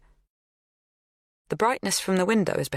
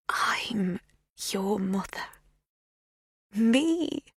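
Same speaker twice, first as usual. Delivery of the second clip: hushed revelation; 6 semitones higher, much slower than usual. A narrator's reading. Magda telling Lexi she is her mother, hushed. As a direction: whisper it, then lift the last word.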